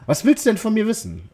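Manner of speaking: lightly voiced girl asking back; feminine voice. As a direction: soften it into a girl's voice. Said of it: "weibliche Betonung reinzukriegen".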